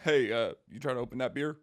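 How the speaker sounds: a hammed-up country bro offering to open a beer; country male voice. Host flirts at a bar with a country accent. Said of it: "That was my country male straight voice".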